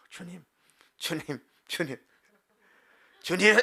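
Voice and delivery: from a hushed murmur up to a loud cry of 주님; building cry to God. Retold or imitated. Worker calling on the Lord in hard moments, hushed to loud. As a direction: start hushed, then cry out the last 주님. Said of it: "막 이러면서".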